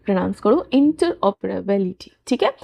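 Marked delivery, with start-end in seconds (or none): 0.7-2.1 interoperability said slowly, split into clear syllables in-ter-op-er-a-bil-i-ty; broken into syllables. Shown as a demonstration. Teacher breaking interoperability into syllables for her students. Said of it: "इसे आप ऐसे तोड़कर pronounce करो".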